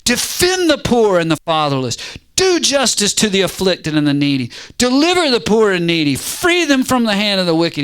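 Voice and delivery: forceful, insistent imperatives; telling God what to do. Acted. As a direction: order God about, each command starting high. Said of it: "He's telling God what to do".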